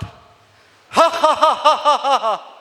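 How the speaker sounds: put-on the boy scholar laughs at the court, loudly; Ashtavakra's laugh. Shown as a demonstration. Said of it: "let us laugh once, like Ashtavakra laughed".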